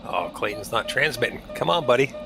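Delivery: usual speaking voice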